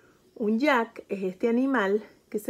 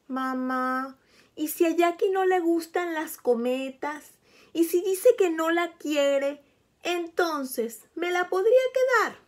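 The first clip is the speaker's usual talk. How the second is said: higher, hopeful little donkey's voice; little donkey child; 6 semitones higher than usual. Acted. Burrito asks Mamá Burra to keep the kite, higher childlike voice. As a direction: ask like a small child angling for the kite.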